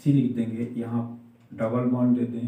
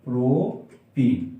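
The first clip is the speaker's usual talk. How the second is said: slow naming; much slower than usual. Shown as a demonstration. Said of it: "इसका नाम हो जाएगा".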